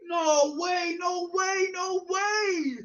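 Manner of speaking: high strained cries of disbelief; despairing wail. Real, not acted. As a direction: keep wailing, voice straining. Continued disbelief, far timbre.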